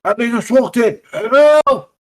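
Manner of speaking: high-pitched victim's cry, rising in panic; screaming for help. Acted. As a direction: act out a man yelling under attack. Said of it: "he starts screaming now".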